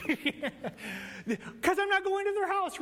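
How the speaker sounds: slow, laughing through it. Real, not acted. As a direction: let the laugh carry the words. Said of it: "laughs at own joke".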